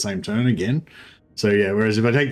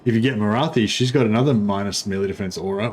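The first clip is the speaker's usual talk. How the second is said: ordinary talk, nothing marked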